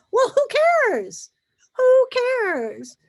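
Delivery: loud, high, brushing it off; exasperated who cares. Retold or imitated. Telling an anxious friend her fears don't matter, loud and high. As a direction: toss the worry aside loudly. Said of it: "I'm like".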